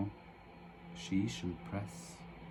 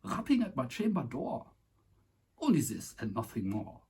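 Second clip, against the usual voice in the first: hammed-up German accent, higher pitch; 5 semitones higher than usual. Finishing the Raven stanza in mock German accent. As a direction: keep the comic German accent through the line. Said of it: "in the German accent".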